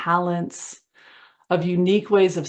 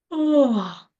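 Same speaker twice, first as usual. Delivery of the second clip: high, falling "oh" sigh; voiced sigh on a relaxing exhale; demonstrated sigh; 8 semitones higher, 4 dB louder than usual. Shown as a demonstration. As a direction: let out an audible sigh as an example. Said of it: "a sigh".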